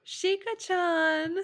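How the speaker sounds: high, slow, stretched cutesy call of the name; sing-song call. Pretend. Reader opens the fanfic, drawn-out cutesy call. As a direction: coo the nickname teasingly. Opening the fanfic reading dramatically.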